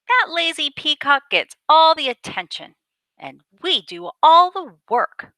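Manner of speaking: high, loud indignant hen complaint; a third hen huffing over the unfair work; squawking hen. A narrator's reading. Indignant hen griping to her sisters about the lazy peacock.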